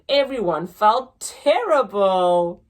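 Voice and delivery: ordinary delivery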